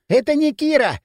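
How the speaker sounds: breathless blurt; urgent panting report. A narrator's reading. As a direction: gasp it out after running. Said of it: "задыхаясь, выпалил подбежавший". (запыхавшись, выпалить)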